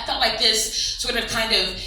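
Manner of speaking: normal talking voice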